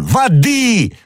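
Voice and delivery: very high, frantic cries; desperate shout. A narrator's reading. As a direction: scream for water. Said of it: "кричал Д'Артаньян". (отчаянный крик)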